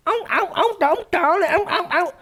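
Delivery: high, simpering mock of a vain poser; mocking CLA pouting. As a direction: coo it high like someone admiring their own lips. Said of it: "pulling fucking C L A expressions".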